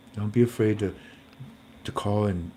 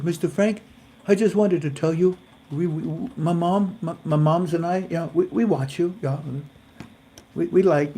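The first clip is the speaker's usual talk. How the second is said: imitating the caller; 6 semitones higher than usual. Voices caller Katarina in story.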